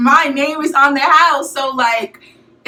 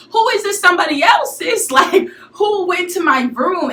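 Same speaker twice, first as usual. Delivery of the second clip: indignant; 7 semitones higher than usual. Real, not acted. Indignant at roommate's excuse.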